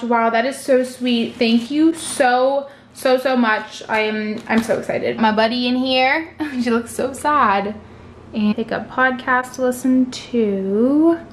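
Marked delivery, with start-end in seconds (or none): none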